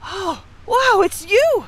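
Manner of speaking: bright, high, delighted cry of recognition; light up with a happy shout of surprise; excited surprise. Acted. Finding the lost friend in the cell, a delighted surprised shout.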